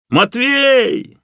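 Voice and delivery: high, ringing shout of a name; loud call. A narrator's reading. Rescuer calls to a man lost in a crevice, loud call. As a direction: call out to someone lost below. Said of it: "громко крикнул Лёха".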